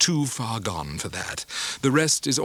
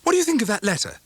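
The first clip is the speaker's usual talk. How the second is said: curious question; 10 semitones higher, 4 dB louder, much faster than usual. A narrator's reading. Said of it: "said Traddles".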